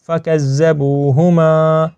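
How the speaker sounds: فَكَذَّبُوهُمَا recited slowly with long BU and MA; correct elongation. Shown as a demonstration. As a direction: hold the "bu" in فَكَذَّبُوهُمَا, chanting slowly. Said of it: "با کو ہم لمبا نہیں کرتے". (فَكَذَّبُوهُمَا میں با کو لمبا کر کے تلاوت)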